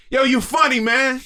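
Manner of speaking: high, loud street holler; retold yell. Stranger yelling at a comedian from a building, retold yell. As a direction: call out like a stranger yelling from a doorway. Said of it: "this dude yelled at me".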